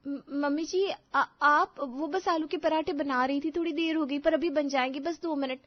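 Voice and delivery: high, anxious, stammering daughter-in-law; nervous stammer. Acted. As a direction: explain yourself anxiously, stumbling at first. Nervous daughter-in-law explains late breakfast. (घबराकर हकलाते हुए, ऊँचे सुर में)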